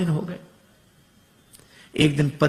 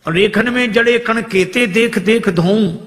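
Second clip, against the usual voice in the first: high, loud verse line; high, loud recited verse; raised verse recital; 7 semitones higher, 5 dB louder than usual. Acted. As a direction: lift the verse out.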